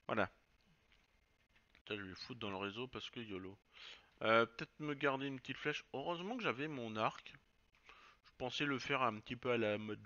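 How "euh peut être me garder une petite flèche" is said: ordinary talk, nothing marked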